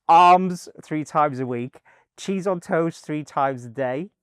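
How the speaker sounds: drawn-out, emphatic incredulity; retold disbelief. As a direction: spell it out slowly in mock dismay.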